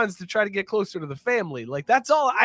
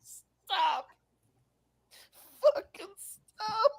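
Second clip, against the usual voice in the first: very high cartoon yelps; Mario sounds; 12 semitones higher, 5 dB louder, much slower than usual. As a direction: squeal like a video game plumber getting knocked about. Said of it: "Fucking Mario sounds for fucking Patino".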